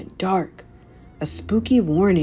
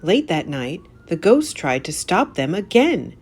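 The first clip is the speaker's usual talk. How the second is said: usual speaking voice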